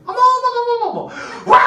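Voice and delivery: high delighted "어머!" then "와!"; excited gasp. Shown as a demonstration. 설교자가 교인들에게 리액션 시범: 놀라며 감탄. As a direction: show the ideal amazed reaction. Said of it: "이 리액션".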